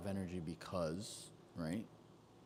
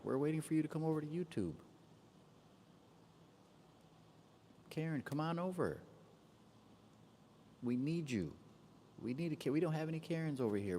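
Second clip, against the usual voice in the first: calling out; 7 semitones higher than usual. Real, not acted. Calling viewer over from TikTok.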